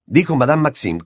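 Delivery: plain reading voice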